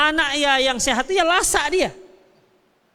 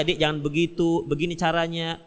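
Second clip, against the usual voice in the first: gentle husband; 8 semitones lower, 4 dB quieter than usual. Retold or imitated. Gentle husband guides wife.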